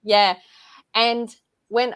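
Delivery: usual speaking voice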